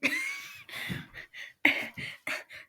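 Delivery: long, high-pitched giggle; giggling. Real, not acted. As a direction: laugh breathlessly at the joke. Amused by the ring line.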